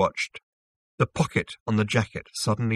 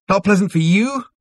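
high, loud outraged bawl; 11 semitones higher, 9 dB louder than usual. A narrator's reading. Zaphod bawls at Zarniwoop over his complaint, outraged. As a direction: bawl it back, pitch climbing. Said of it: "bawled Zaphod".

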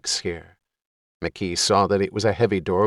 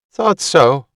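high, squeaky fat woman; 7 semitones higher, 9 dB louder than usual. Acted. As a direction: pitch it up, thin and squeaky. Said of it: "spoke in a squeaky voice".

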